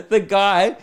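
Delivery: high, loud words through laughter; laughing. Real, not acted. Storyteller laughing about the car owner, laughing voice.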